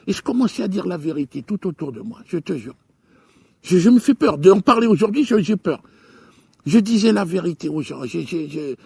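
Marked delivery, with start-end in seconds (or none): none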